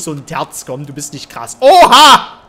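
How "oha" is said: loud, high-pitched burst of surprise; startled shout. Real, not acted. Player reacting to the game, startled high shout. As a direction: shout out in sudden amazement. Startled outburst during live gameplay.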